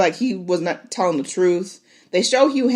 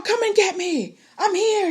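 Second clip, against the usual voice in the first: retold yell; 14 semitones higher than usual. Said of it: "yelling at Vermithor".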